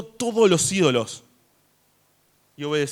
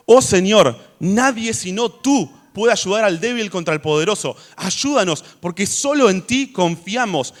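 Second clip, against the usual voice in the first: louder, higher pleading prayer voice; fervent prayer; 3 semitones higher, 4 dB louder than usual. Acted. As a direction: cry out to God for help. Reads Asa's prayer aloud.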